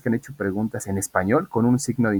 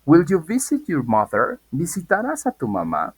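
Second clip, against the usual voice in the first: modelo de pregunta; 6 semitones higher than usual. Shown as a demonstration. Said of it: "Y entonces digo".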